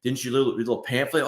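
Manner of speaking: usual speaking voice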